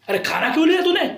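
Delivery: high, loud retold yell; mock yelling. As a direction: shriek it like a shrill coordinator. Coordinator yells again, retold.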